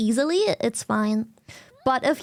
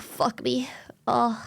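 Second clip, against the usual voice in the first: normal talking voice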